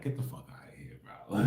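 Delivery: unvoiced hushed brush-off; whispered dismissal. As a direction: breathe it out under your breath like you don't believe a word. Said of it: "I be like".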